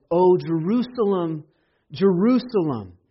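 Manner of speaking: a lamenting call. Acted. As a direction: cry it out in grief over the city. Voicing Jesus lamenting.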